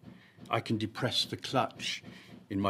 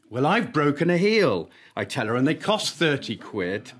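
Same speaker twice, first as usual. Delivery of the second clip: defensive protest; 7 semitones higher, 10 dB louder than usual. Acted. Narrator answering after crash.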